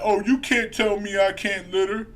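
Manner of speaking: mock tantrum of a scolded child; whiny kid. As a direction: sulk it out like a ten-year-old caught littering. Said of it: "That's what you sound like".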